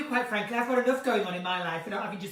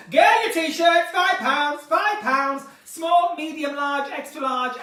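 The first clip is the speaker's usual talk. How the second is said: louder, higher hawker's call; playful market trader hawking t-shirts, louder and higher; market stall trader; 5 semitones higher, 9 dB louder, slower than usual. Pretend. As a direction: cry the wares like a market trader. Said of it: "I feel like a market stall".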